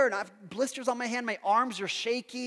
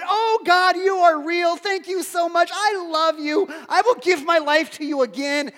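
higher, louder cry of thanks to God; ecstatic praise; 8 semitones higher, 7 dB louder than usual. Retold or imitated. Preacher reliving relief, praising God aloud, higher and louder. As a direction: pour it out in joyful relief. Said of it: "I'm just praising God".

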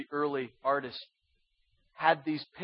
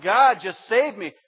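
louder, higher plea to God; crying out; 4 semitones higher, 8 dB louder than usual. Acted. Apostles facing death pray to God, crying out. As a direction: call out in a mock desperate prayer. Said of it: "I'm sure they're crying out".

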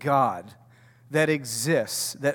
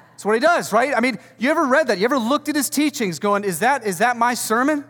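much higher and louder, fast; excited loud preaching; 8 semitones higher, 5 dB louder than usual. Real, not acted. Pastor to congregation, loud excited amusement. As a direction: burst out with amused energy. Preacher laughing at Jesus' style.

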